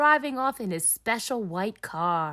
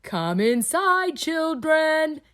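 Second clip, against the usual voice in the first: calling out; 8 semitones higher, 4 dB louder than usual. Acted. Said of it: "calls Daddy Pig".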